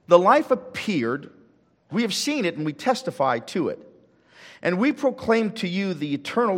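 solemn, measured Bible reading; scripture reading. Acted. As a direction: read it like holy words to the congregation. Reads scripture aloud.

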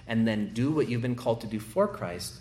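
ordinary delivery

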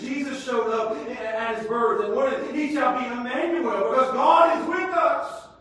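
loud, high, sing-song pulpit cadence; climactic preaching. Real, not acted. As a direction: ring it out at the climax.

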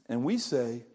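ordinary talk

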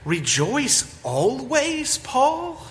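a skeptic baffled by the command; doubtful listener. Retold or imitated. Imagined listener questioning Paul's command, incredulous. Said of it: "You might say".

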